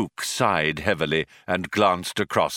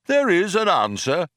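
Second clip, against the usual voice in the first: raised, lightly voiced oracle; computer pronouncement; 10 semitones higher than usual. Computer confirms to the programmers, lightly voiced pronouncement. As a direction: confirm it like a solemn machine.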